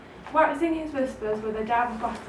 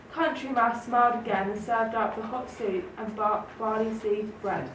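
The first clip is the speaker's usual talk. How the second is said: plain reading voice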